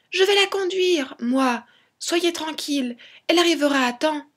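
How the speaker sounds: put-on high, eager little boy's voice; Tom Pouce. A narrator's reading. Tiny son offers to drive the cart, high childlike voice. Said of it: "s'écria Tom Pouce".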